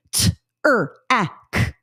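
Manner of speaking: "T, er, ak" said one sound at a time with gaps; separate phonemes. Shown as a demonstration. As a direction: segment "T, er, ak" sound by sound. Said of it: "individual sounds in words".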